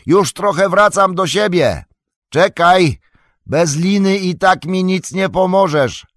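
high, loud call; strong, high-pitched distant shout; shouting back. A narrator's reading. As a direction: shout up firmly over the storm. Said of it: "odkrzyknął Frodo mocniejszym już głosem".

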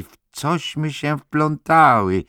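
głośny, bardzo wysoki, przeciągły okrzyk. A narrator's reading. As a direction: wykrzyknij to wysoko i powoli. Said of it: "krzyknęła Sandy". (przeciągłe wołanie przejętej dziewczyny)

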